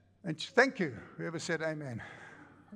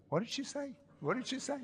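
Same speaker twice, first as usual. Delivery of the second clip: high, softer bewildered repeat; puzzled; 6 semitones higher, 4 dB quieter than usual. Retold or imitated. Husband baffled after his wife walks out, puzzled. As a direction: suddenly realise you missed it.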